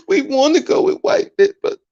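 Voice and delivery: squeaky falsetto crybaby imitation; hammed-up sobbing protest, sky-high; mock crying. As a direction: whine it very high, making fun of the complainers. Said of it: "cry in that goddamn corner".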